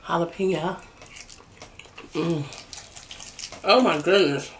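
talking while chewing; chewing spicy pepper; mouth full. Real, not acted. Seen 1: Jalapeno bitten at -2; mouth visibly working food while speaking.

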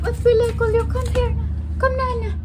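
high, sing-song calling to a pet; high coaxing call. Real, not acted. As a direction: coax the pet over in a high voice.

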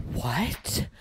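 loud, breathy game bark; imitating a voice line. Friend imitating a weird game voice line, loud. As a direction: mimic the strange sound. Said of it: "like, a voice line".

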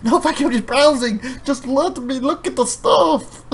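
high, slow, pleading "just let me look"; exasperated refusal. Retold or imitated. Fabi begging a pushy clerk to leave him alone, exasperated. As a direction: beg to be left alone. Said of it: "Fabi's like".

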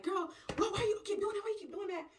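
high-pitched protest; exasperated. Acted. Scolded friend complaining to the real friend, exasperated.

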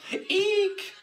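shrill princess eek; high eek scream. A narrator's reading. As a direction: squeal it high and loud. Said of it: "screamed the princess".

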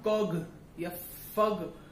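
कॉग and फग read with a g ending; mock the mistaken g sound in कॉग and फग; teacher demos the wrong g ending. Shown as a demonstration. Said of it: "ऐसा नहीं पढ़ेंगे".

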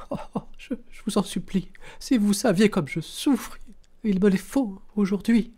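high, sobbing woman's begging; tearful stammering plea. A narrator's reading. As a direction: stammer and implore, high-pitched, as if crying. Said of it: "Elle pleurait et bégayait". (supplication aiguë, pleurante et bégayante)

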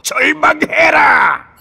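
triumphant shout. Acted. Villain proclaims her despair.

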